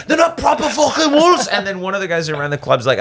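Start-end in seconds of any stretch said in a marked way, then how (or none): 0.1-1.5 very high-pitched, caricatured Scouse complaint; mad Scouser. Acted. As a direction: insist shrilly like an outraged Liverpool local. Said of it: "he's just mad".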